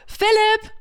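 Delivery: high, far-carrying call across the market; loud call. Retold or imitated. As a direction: shout the name out to him. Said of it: "Sie ruft ganz laut". (lauter Ruf über den Markt)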